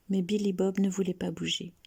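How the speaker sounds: usual speaking voice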